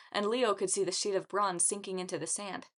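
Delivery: ordinary delivery, nothing marked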